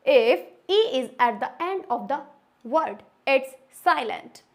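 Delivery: very slow dictation of the rule; slow rule reading. Shown as a demonstration. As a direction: spell the rule out word by word. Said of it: "rule number five".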